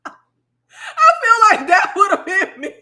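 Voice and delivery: slow, high, loud line through laughter; laughing. Real, not acted. Imagining calling 911 high, laughing at herself. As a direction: laugh it out at yourself.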